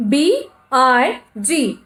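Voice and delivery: B I G sounded out letter by letter, slowly; teacher sounding out the letters of a word. Shown as a demonstration. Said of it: "what is the word here?".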